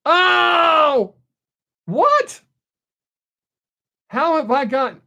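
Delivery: real shock at being reported, yelled high; indignant disbelief. Real, not acted. Streamer finds out viewers snitched, shouting in high disbelief. Flagged again on TikTok.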